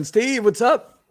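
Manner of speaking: high, loud, drawn-out greeting; calling in a guest. Real, not acted. Host brings on friend Steve, calling him in. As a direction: welcome a friend in with a big stretched hello.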